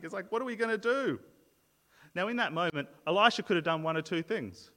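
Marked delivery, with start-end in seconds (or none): 0.3-1.2 raised pitch, acted-out fretting; mild panic. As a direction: play a servant starting to panic. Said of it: "he's beginning to panic".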